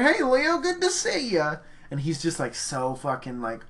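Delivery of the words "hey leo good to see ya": overeager greeting. Retold or imitated. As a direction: greet him like a big-shot director. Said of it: "I'm like".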